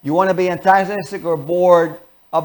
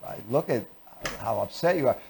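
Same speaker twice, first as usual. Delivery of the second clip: bored; 4 semitones lower than usual. Shown as a demonstration. Spouse coming home to an angry partner, bored. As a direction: drag every word out with no interest. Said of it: "You come in bored".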